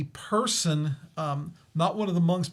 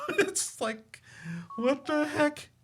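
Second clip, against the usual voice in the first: laughing disbelief; 12 semitones higher, 3 dB quieter, slower than usual. Real, not acted. IPad rebooting mid ghost story.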